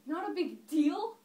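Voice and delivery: loud, high, incredulous cry with a rising end; full-force scandalised question; indignant outburst. Acted. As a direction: throw it back slowly, outraged and disbelieving. Acted monologue, indignant at cruel joke.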